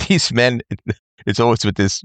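ordinary delivery

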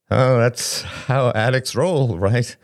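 slow it down and pitch it up, uneasy; awkward, laughing it off. A narrator's reading. Derek answers Shane's taunt, awkward. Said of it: "He laughed awkwardly".

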